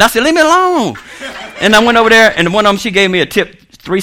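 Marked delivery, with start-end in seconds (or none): 0.2-1.0 high, whiny brush-off; mock protest. Waiter telling his boss to back off, mock protest. Retort to boss mid flirting.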